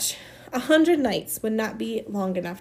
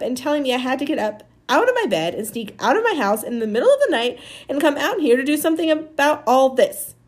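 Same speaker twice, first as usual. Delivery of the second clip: higher, emphatic telling-off; angry scolding; 5 semitones higher, 4 dB louder than usual. Acted. Amanda tells Maniac why he's sorry, indignant scolding. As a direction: pile up the grievances, hammering key words.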